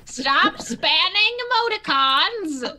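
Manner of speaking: slow, high mock-stern bot; hammed-up strict chat bot; scolding bot.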